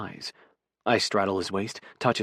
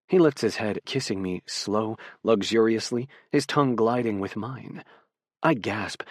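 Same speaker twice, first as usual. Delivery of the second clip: usual speaking voice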